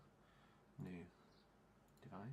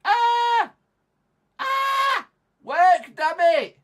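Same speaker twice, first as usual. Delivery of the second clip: very high, very loud screams; full-voiced furious shrieking; screaming in frustration; 18 dB louder, much slower than usual. Real, not acted. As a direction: scream commands at the broken computer. Screaming at the computer to work.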